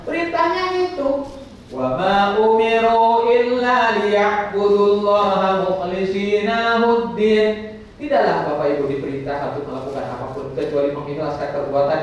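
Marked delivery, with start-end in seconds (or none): 1.7-7.8 slow, loud chanted Arabic verse; Quranic recitation. Shown as a demonstration. Preacher reciting the verse to pilgrims, chanted slowly.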